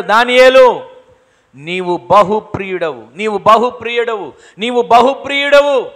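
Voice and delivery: a preacher voicing the angel's loud call, building; angel's proclamation. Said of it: "మిఖాయేలు దూత వచ్చి చెప్తున్నాడు".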